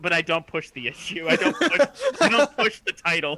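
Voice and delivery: loud joking protest. Real, not acted. Player insists he won't push the title, loud and amused. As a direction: talk loudly over the laughter, pitch climbing. Player jokes over others' laughter.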